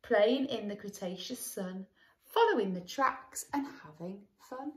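put-on sing-song read-aloud for children; lilting rhyme for a little listener; storybook narration. A narrator's reading. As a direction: read it like a bedtime picture book. Reading a picture book aloud.

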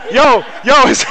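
loud, high excited yo. Real, not acted. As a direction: call out in surprise. Excited reaction mid-laugh.

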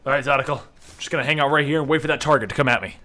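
ordinary delivery

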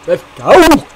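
loud, high hype cry; hype call. Pretend. Hyping up the spicy menu, loud hype cry. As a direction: cheer it like a hype man.

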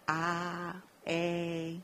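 the two "A" sounds contrasted; short and long a. Shown as a demonstration. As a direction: show the vowel contrast. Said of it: "la diferencia entre A y A". (contraste de vocales "A")

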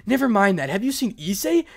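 loud, high panicked cry; desperate, frantic plea; hysterical. A narrator's reading. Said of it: "cried Rias hysterically".

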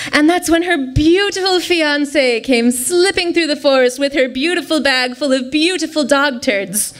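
usual speaking voice

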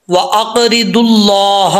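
slow recitation. Shown as a demonstration. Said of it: "अगली line पे है".

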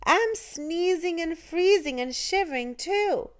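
put-on cold little girl's voice. A narrator's reading. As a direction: speak up like a chilly little girl. Little girl speaking.

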